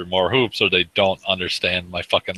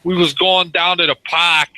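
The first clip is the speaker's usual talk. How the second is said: mock East Coast accent; 10 semitones higher, 6 dB louder than usual. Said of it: "the East Coaster".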